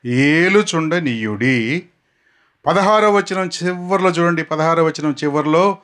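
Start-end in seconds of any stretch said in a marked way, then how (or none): 0.0-1.8 ఏలుచుండనీయుడి stretched slowly; slow memory word. Shown as a demonstration. Preacher stretching the word so the crowd remembers. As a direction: drill it in, slowly. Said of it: "మీరు గుర్తుపెట్టుకోవాల్సిన మాట".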